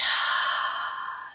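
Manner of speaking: long audible meditation breath; drawn-out breathing-exercise sigh; demonstrated deep breath. Shown as a demonstration. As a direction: breathe slowly and audibly to model it.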